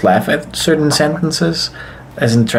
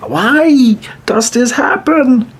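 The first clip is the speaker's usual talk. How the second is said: exasperated whine; 12 semitones higher, much slower than usual. Real, not acted. Self-conscious complaint, comic whine.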